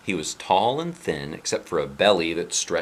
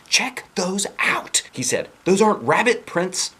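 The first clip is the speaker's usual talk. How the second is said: put-on much higher, louder boyish voice; excited boy; 13 semitones higher, 5 dB louder than usual. Boy insisting to teacher about prints, excited. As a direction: push it out like an eager kid.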